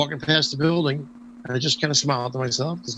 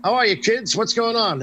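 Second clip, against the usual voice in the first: higher, hearty call-out; booming greeting; 8 semitones higher, slower than usual. Retold or imitated. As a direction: boom it out to the kids. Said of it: "And I boom out".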